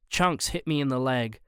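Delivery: ordinary delivery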